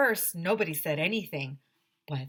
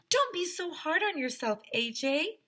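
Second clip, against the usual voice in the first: comforting teacher; 9 semitones higher than usual. Acted. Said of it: "said Mister Mackey".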